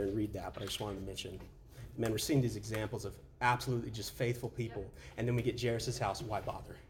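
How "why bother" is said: ordinary delivery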